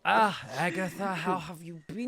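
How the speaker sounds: put-on high, accented gushing host; effusive fishmonger. Fishmonger asking Agatha how she is, effusive showman. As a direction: fuss over a favourite customer. GM voices Etienne.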